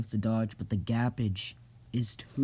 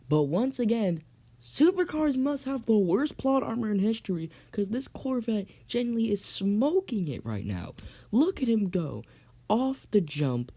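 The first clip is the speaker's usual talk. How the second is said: higher-pitched, rousing race call; announcer voice; 10 semitones higher, 3 dB louder than usual. Acted. Announcer presenting Corvette against Lamborghini, rousing announcer voice. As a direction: hype the race to the crowd.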